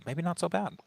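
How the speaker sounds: high, light reassuring quote; mentor's reply. Retold or imitated. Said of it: "he said".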